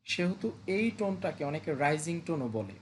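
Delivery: normal talking voice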